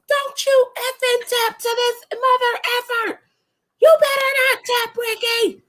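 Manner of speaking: very high, full-throated crowd yell; retold yell. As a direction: yell a desperate warning up at the wrestler. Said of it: "stood up and yelled".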